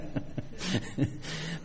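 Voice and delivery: soft unvoiced laugh at his own joke; a quiet breathy laugh; breathy chuckle. Real, not acted. Preacher chuckling at his Pope joke, soft laugh.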